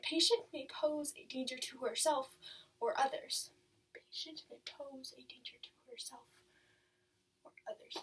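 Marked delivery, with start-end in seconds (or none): 3.9-8.0 whispered, barely audible repetition; hushed echo. Acted. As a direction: let the words sink in under your breath. File note echoed to herself.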